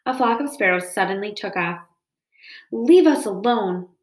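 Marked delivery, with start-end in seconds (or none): 2.7-3.9 loud, drawn-out weasel squeak; frightened squeak. A narrator's reading. Weasel crouched in the grass warns off the robot, squeaking. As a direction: squeak it out slowly and louder. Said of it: "squeaked Dart as he crouched low".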